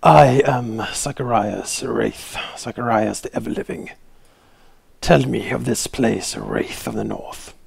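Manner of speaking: slow solemn undead tongue; speaking Necro. Acted. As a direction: intone it slowly like an ancient lich. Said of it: "in Necro".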